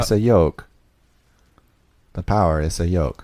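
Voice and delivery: put-on Spanish accent, J turned to Y; Alonso impression. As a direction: imitate a Spanish speaker saying "joke" as "yoke". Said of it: "It's something he said last season".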